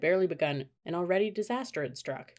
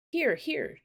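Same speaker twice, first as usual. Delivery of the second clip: high, carrying call; 10 semitones higher than usual. A narrator's reading. Annie calling the wildebeest to the sandy spot, high carrying call. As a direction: call the herd over to you. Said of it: "she called".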